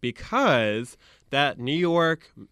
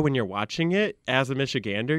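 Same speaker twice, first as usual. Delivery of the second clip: normal talking voice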